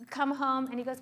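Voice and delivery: ordinary talk, nothing marked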